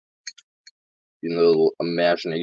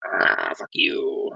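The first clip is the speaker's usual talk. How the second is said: slow, breathy whispered curse; whispered mock insult; much slower than usual. Imagined passerby cursing him, slow whisper. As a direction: hiss it out slowly under the breath. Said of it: "maybe a little bit of".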